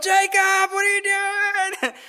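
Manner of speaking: high, drawn-out mock-panicked cry; hammed-up high protest; mock alarm. Retold mock panic in speeding car.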